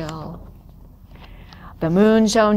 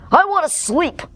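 higher-pitched leopard growl; 8 semitones higher than usual. Acted. Leopard who wants to sleep, growling. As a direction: growl it in annoyance. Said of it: "growled the leopard".

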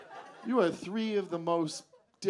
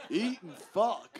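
put-on slow, drawn-out old man; 3 semitones higher, slower than usual. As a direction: drag it out like a grumpy old-timer. Comic mimics old man's answer.

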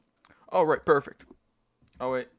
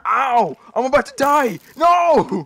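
rising panic, louder and higher; panicked yelling; 6 semitones higher, 9 dB louder, slower than usual. Real, not acted. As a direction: yell in panic as mobs attack. Mobs attacking in Minecraft.

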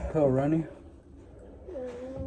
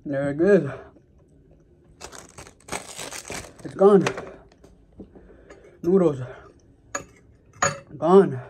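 excited; 3 semitones higher, 3 dB louder, slower than usual. Real, not acted. Spicy food finally swallowed.

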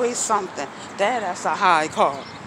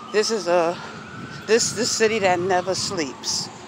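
normal talking voice

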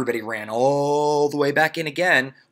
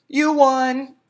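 1 called back; 8 dB louder than usual. Acted. Louis calls back.

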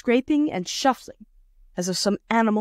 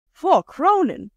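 a louder, higher outcry at the price; mild mock outrage at a price; shocked exclamation; 8 semitones higher, 4 dB louder, slower than usual. A narrator's reading. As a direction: blurt it out in shock. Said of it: "exclaimed Abbleway".

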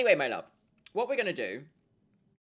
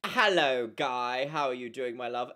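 ordinary delivery

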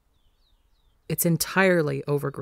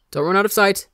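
louder warning called out; calling warning; 4 semitones higher, 6 dB louder, faster than usual. A narrator's reading. Phil calling to the boys before they get far, a loud warning. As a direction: call it after kids running off. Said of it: "he warns".

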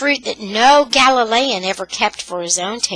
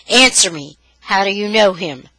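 loud, hard command; furious demand; 5 dB louder than usual. Acted. Brother demanding answers from his sister, furious. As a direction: bark the order at her.

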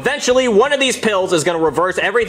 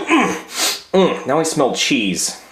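normal talking voice

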